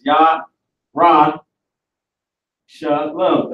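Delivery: "Yah, Ra, Shalom" as separate beats, YAH, RAH, sha-lum; syllable breakdown. Shown as a demonstration. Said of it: "the letters is exactly how it is".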